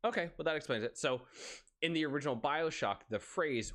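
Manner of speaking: usual speaking voice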